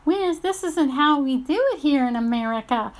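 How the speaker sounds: a hint of a sneering know-it-all; mocking quote. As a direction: parrot their line with scorn. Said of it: "those people that wanna say".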